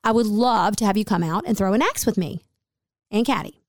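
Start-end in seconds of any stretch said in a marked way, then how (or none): none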